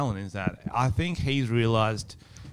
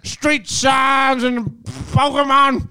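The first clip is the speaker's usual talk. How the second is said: much higher, louder cartoonish Cosby rant; Trump doing Bill Cosby; 11 semitones higher, 5 dB louder than usual. Podcaster turns his Trump into Bill Cosby: high, loud, hammed-up. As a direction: go high and loud, hammy old man ranting. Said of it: "Donald Trump doing Bill Cosby".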